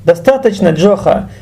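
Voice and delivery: ordinary talk, nothing marked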